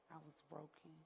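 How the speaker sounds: barely voiced, very soft; hushed admission. Real, not acted. To the audience, a near-silent confession of despair. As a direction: let it fall almost to nothing. Refrain of her lowest point.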